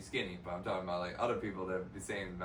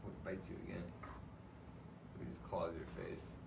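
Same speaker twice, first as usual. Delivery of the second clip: soft, hushed talk to the cat; soft pet talk; 4 semitones lower, 14 dB quieter, slower than usual. Real, not acted. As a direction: coo quietly at the cat while playing. Talking to the cat.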